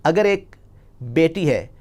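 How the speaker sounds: ordinary delivery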